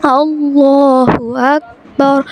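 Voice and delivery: अल्लाहु very slow, long and full; measured teaching chant of अल्लाहु अकबर; careful tajweed recitation. Shown as a demonstration. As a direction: draw out अल्लाहु and round the रा of अकबर. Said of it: "अभी हम पढ़ते हैं इसको".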